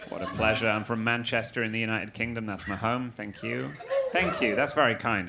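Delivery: ordinary delivery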